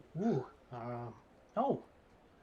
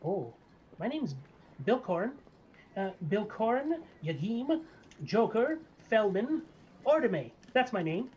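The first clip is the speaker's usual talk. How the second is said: higher, nervous then proud little barber; gnome barber; 4 semitones higher, 3 dB louder than usual. Acted. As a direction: fret about danger, then boast your name. GM voices the barber.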